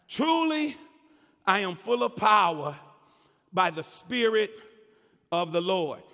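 firm, emphatic preaching voice with pauses; scripture proclamation. Acted. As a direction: proclaim each phrase to the congregation.

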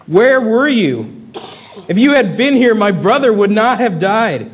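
high, loud, pained cry to God; grieving sister crying out to Jesus, anguished; anguished plea. Acted. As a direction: plead with God, voice straining. Preacher voicing Mary's anguished cry.

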